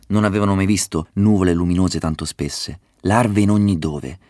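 usual speaking voice

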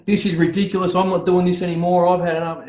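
re-enacted fed-up outburst, pitched high; high-pitched exasperated rant, retold; retold meltdown. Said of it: "I've just lost my shit".

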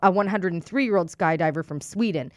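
steady news-anchor reading. Acted. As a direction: read it like a news story. Reads news item.

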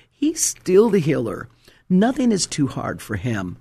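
usual speaking voice

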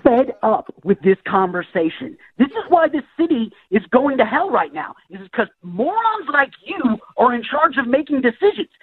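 increasingly irate complaint; fed up. Real, not acted. Fed-up caller berates the prankster.

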